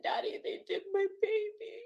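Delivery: high, tearful, crying voice. Real, not acted. Telling her father about the baby, openly crying. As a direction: let the sobs break through.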